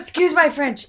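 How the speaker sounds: normal talking voice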